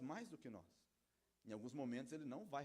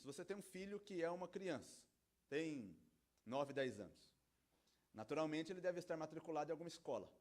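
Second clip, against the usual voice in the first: ordinary talk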